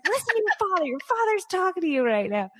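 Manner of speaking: high-pitched exasperated mom; scolding mom. Retold or imitated. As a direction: scold him in a shrill-high parent voice. Mom scolding son in story.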